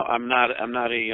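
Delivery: usual speaking voice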